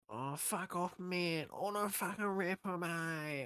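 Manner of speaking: hammed-up train-station addict; mock bogan crackhead. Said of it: "it's always".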